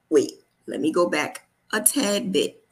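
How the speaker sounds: usual speaking voice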